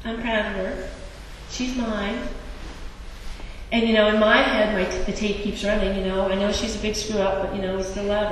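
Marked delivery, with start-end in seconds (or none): 0.0-2.2 soft, tender boast, slightly lifted pitch; quiet fatherly pride. Acted. God looking down from a star, soft proud words. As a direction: confide your pride softly, phrase by phrase. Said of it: "and saying".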